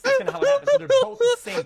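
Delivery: very high, loud laughing words; bursting into laughter; laughing loudly. Real, not acted. Laughing loud mid-explanation.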